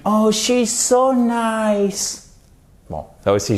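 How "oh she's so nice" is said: very high, drawn-out coo; exaggerated gushing. Teacher showing pet-owner talk, exaggerated gushing. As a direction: overdo the adoration, high and slow. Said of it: "j'exagère un petit peu".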